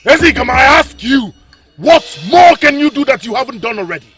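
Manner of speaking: loud, scornful demand; contemptuous shout; defiant challenge. Acted. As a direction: taunt him openly. Prince challenges the king.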